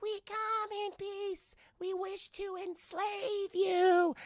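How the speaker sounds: high, very slow alien monotone; a cartoon alien making a polite threat; little aliens greeting the humans, high and slow. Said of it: "they're like".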